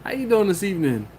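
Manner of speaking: loud, high, hyped-up host greeting. Retold or imitated. Reading a viewer's chat greeting in a loud, high hype voice. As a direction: shout it out like a club hype man. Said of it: "Wild Bill, he said".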